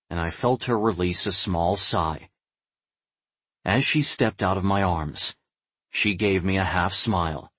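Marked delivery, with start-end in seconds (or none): none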